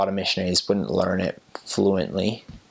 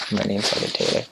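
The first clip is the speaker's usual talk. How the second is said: Ghanaian accent; faster than usual. Shown as a demonstration. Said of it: "E R ends up sounding like an A".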